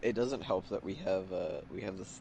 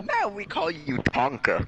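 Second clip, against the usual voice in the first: put-on German accent; 11 semitones higher, 8 dB louder, slower than usual. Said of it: "The way you said Tonka sounded fucking German".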